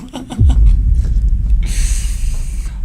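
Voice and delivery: breathy, high laughter; laugh after a scare. Real, not acted. Player admits being scared, relieved laughter. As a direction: laugh off your own fright.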